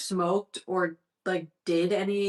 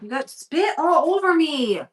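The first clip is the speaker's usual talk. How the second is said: surprised protest; 7 semitones higher, 4 dB louder than usual. Real, not acted. Dog slobbers on her.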